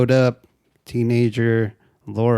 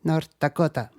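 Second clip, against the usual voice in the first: mock Spanish accent, higher pitch; Laura; 5 semitones higher than usual. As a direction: say it like a Spanish-speaking little girl. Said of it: "She had a fat ass accent".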